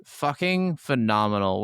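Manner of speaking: ordinary delivery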